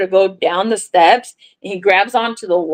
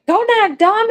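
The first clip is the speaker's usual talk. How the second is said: husband's accusation; 12 semitones higher than usual. Retold or imitated. Husband confronting her about cat.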